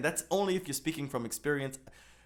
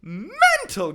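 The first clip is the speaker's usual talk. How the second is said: high, loud, laughing exclamation; loud laughing disbelief; laughing outburst; 12 dB louder, slower than usual. Real, not acted. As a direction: burst out with it in disbelief.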